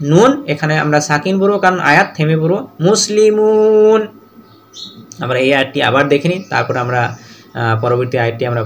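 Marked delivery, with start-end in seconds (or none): 2.9-4.0 slow, drawn-out chanted Quranic reading; tajweed recitation. Shown as a demonstration. Teacher reciting the verse ending, slow chant. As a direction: intone it slowly like recitation.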